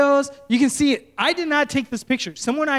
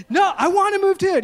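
high, loud exasperated cry; exasperated protest; 9 semitones higher, 4 dB louder than usual. Retold or imitated. Pastor protesting to the angry driver, exasperated. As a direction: plead that you want to move too. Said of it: "protesting to the driver".

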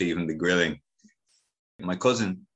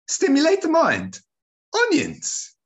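much higher, louder incredulous auntie; squealing aunt; 5 dB louder than usual. Retold or imitated. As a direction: shriek it up high in disbelief. Auntie flabbergasted at onion rule.

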